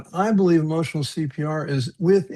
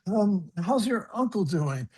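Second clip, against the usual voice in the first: awkward doctor; 5 semitones higher, slower than usual. Retold or imitated. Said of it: "and he's like".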